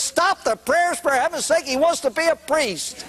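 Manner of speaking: high, frantic cry to stop; high-pitched plea, half laughing; panicked daughter. Acted. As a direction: she bursts in begging them to stop.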